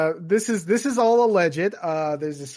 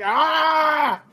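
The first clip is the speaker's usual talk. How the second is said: startled shout; 9 semitones higher, 5 dB louder than usual. Retold or imitated. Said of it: "He's just like".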